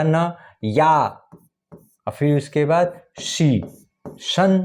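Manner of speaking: slow, deliberate spelling of आधा न, या, शी; slow syllable breakdown. Shown as a demonstration. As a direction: stretch out each sound of सन्यासी. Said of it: "जैसे जैसे आप उच्चारण करते हो".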